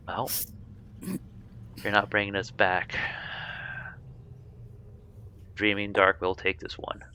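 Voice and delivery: a menacing presence speaking inside the mind, softly; ominous hushed voice in the head; eerie voice in head. Acted. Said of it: "you hear a voice in your head".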